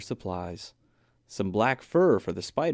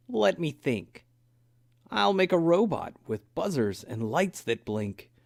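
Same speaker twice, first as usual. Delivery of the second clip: put-on young bear voice; 7 semitones higher than usual. A narrator's reading. Buddy's voice in story.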